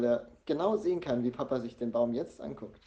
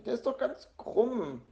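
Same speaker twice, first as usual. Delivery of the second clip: higher-pitched, exasperated mother; mildly indignant scolding; annoyed; 8 semitones higher than usual. A narrator's reading. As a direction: argue in cross disbelief. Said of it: "sagt Mama ärgerlich".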